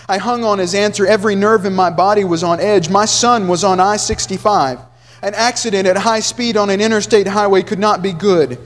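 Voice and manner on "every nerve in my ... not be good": ordinary talk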